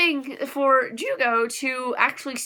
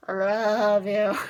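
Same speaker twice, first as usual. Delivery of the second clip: tender confession; 3 semitones lower, much slower than usual. Real, not acted. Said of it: "But Jugo".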